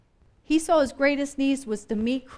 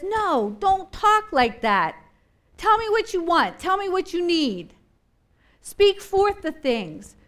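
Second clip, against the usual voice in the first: Jesus commanding; 6 semitones higher, 4 dB louder than usual. Retold or imitated. Preacher voicing Jesus urging the listener, higher and louder. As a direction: order them to speak their need. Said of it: "Jesus says".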